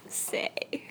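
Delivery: playful clam-up whisper; secretive whisper. Acted. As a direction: breathe the word out in a mock-secret whisper. Teasing refusal to reveal plans.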